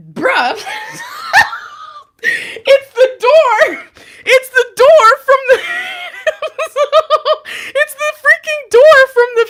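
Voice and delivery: high, loud laughing shriek through the words; shrieking laughter. Real, not acted. Tarot reader seeing the door card return, shrieking with laughter. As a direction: cackle it out in disbelief, very high.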